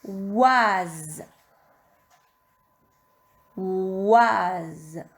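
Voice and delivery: "Oise" stretched, with a buzzing held z; syllable dictation. Shown as a demonstration. Teacher dictating the third syllable, slow and drawn out. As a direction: dictate "Oise" very slowly, every sound held. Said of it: "Troisième syllabe".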